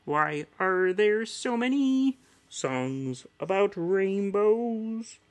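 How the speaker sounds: Kermit the Frog voice. Shown as a demonstration. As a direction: wonder aloud like the famous frog. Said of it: "My Kermit's okay".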